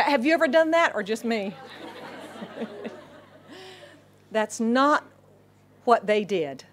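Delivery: ordinary talk, nothing marked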